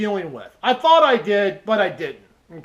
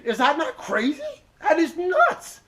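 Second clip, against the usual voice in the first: incredulous; 6 semitones higher than usual. Real, not acted. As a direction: stretch it out in disbelief. Exasperated about the lockout.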